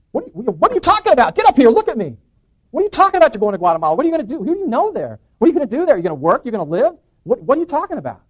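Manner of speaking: high, loud, alarmed grilling; loud, worked-up disbelief; incredulous outburst. Acted. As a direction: fire disbelieving questions at your brother, voice way up. Retold alarmed grilling of his brother.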